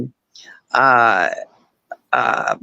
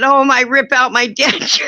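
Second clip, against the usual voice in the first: laughing; 7 semitones higher than usual. Real, not acted. Laughs at own story.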